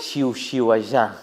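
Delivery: "Xiu, xiu" with hushing sh, "allá" with a voiced zh; Catalan pronunciation. Shown as a demonstration. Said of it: "¿Y cómo se pronuncia?". ("Xiu, xiu, allá" con sh sorda y final sonora)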